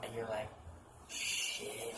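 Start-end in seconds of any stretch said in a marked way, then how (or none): 1.1-1.9 whispered, stunned reaction; awed whisper. Retold or imitated. Reader reacting to the story, in an awed whisper. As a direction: breathe it out under your voice, impressed.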